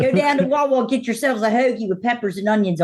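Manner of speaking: high-pitched, broad regional Baltimore voice; Baltimore accent. Shown as a demonstration. Guest showing off the local voices, hammed-up Baltimore accent. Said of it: "I can do a".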